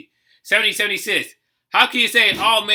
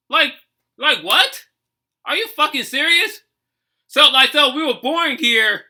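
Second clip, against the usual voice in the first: higher, heated disbelief; indignant outrage; 5 semitones higher, 4 dB louder than usual. Real, not acted. Reactor venting at the Dred Scott ruling, heated higher-pitched outrage. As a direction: let the outrage climb in pitch.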